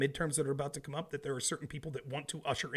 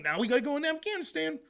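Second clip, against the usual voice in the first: high sarcastic official voice; mocking official; 12 semitones higher than usual. Mocking officials justifying Afghanistan. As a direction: mimic a smug government line. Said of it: "and then saying".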